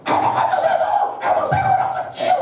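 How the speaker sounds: squealing high mocking voice of the ranting lady; imitating angry woman. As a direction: shriek it back, playing the furious woman. Imitates angry woman shouting back.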